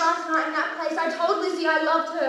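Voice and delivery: loud, anguished confession; distraught outburst. Acted. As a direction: break down and push it out. Mother's distraught outburst to husband.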